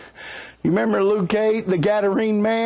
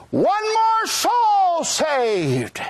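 heavenly announcement; 9 semitones higher, much slower than usual. Retold or imitated. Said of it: "Somebody says".

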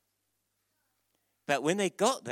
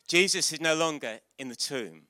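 normal talking voice

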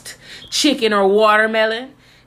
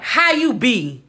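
slow, drawn-out mock greeting, pitched up; hammed-up, higher slang hello; imitated slangy greeting; 4 semitones higher, slower than usual. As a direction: stretch it out, imitating her forced slang. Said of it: "She'd be like".

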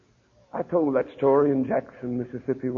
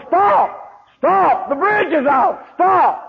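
high, loud desperate yells of Stop; hollering a warning; 12 semitones higher, 5 dB louder, much slower than usual. Retold or imitated. Man on the road yells at drivers, loud and high. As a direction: shout to warn oncoming cars off the bridge. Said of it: "He hollered".

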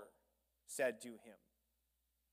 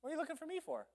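put-on high, cheeky teenage retort; bold adolescent; 13 semitones higher, much faster than usual. Young Jesus answers his mother, high cheeky teen. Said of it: "this is pretty bold".

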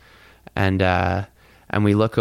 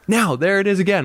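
excited; 10 semitones higher, 3 dB louder than usual. Retold or imitated. Excited shout about the light.

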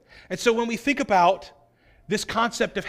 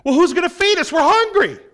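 high, loud complaining whine; a loud, high grumble from hungry followers; whiny hungry disciples; 10 semitones higher, 10 dB louder than usual. Acted. Preacher mimicking disciples loudly demanding food.

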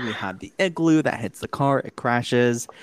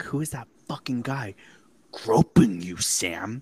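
hammed-up jealous accusation, building louder; aggressive confrontation; 3 semitones higher, 4 dB louder than usual. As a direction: play an aggressive ex demanding answers. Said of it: "a little bit aggressive when she was like".

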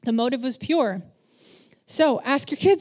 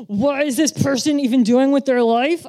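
louder, exasperated grumble at someone; exasperated inner voice; 3 semitones higher, 6 dB louder than usual. Retold or imitated. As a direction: vent it like you're fed up with them. Her own frustrated inner voice.

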